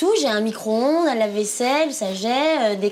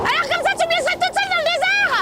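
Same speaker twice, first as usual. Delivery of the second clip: high whiny protest; 11 semitones higher than usual. Acted. Wife stranded, calling husband back.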